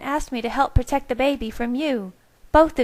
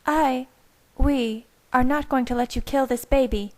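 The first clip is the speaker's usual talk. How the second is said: higher voiced, spat in fury; Rosalie spitting; 7 semitones higher than usual. A narrator's reading. Rosalie defies Carlisle to protect the baby, spitting angrily. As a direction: spit the words out as Rosalie. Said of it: "spat Rosalie".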